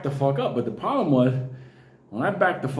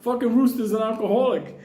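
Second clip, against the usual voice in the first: ordinary talk, nothing marked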